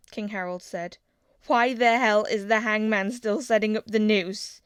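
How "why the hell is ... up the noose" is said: louder angry question to his father; angry demand. A narrator's reading. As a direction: confront the king about the noose. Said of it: "he asked".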